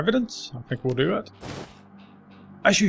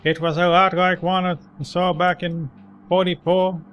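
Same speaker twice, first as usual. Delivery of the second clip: put-on old man voice; 5 semitones higher than usual. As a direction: recall it like a grizzled veteran. Old man describes the troll.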